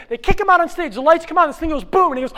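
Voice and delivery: loud, high rush of storytelling; frantic narration. A narrator's reading. Teller races through the dash downstairs, frantic. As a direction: race through it urgently.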